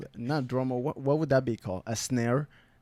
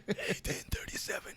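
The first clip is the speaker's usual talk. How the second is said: put-on whispered wheezy old man; 10 dB quieter than usual. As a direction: rasp it out like a frail elder. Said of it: "Hence the old man voice".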